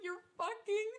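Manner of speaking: loud, high-pitched disbelief; retold exasperated cry; incredulous outburst. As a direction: burst out at his outrageous behavior. Reacting to being hit, retold.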